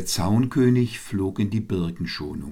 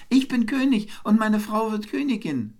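very high, triumphant little-bird voice; calling wren. A narrator's reading. Wren claims victory over the eagle, calling out high. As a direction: crow your victory in a tiny high voice. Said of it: "rief er".

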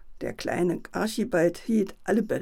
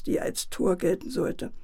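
plain reading voice

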